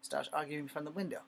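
normal talking voice

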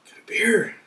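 breathy hushed aside; whisper. Real, not acted. Reviewer whispering to camera about his beer. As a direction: whisper it like a little secret.

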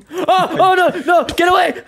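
high panicked screaming. Real, not acted. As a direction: shriek at the creeper. Creeper attacks in game.

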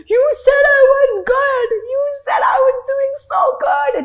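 high, loud, hammed-up crying accusation; mock tearful wail. Sobbing to Hindi teacher on the phone, mock tearful wail. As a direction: wail it like a wronged child, exaggerated.

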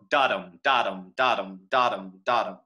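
low, even DUM-da chant on "dottum"; trochaic beat. Shown as a demonstration. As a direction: tap out "dottum" with the first syllable stressed. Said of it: "people talk about trochaic lines".

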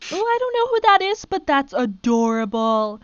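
delighted fawning over a tiny pet; gushing adoration. Real, not acted. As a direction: squeal it, then draw out the adoring words. Gushing over a cute dragon.

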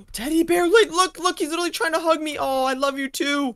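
delighted. Acted. Delighted by a teddy bear.

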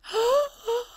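high, sudden gasp; sharp intake of breath; startled gasp. Real, not acted. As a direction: gasp in alarm. Sudden alarm at the game.

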